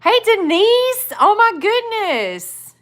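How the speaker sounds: high, drawn-out squeal of hello; excited greeting. Real, not acted. Streamer greeting Denise in the chat, excited squeal. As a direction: stretch each word out high and delighted.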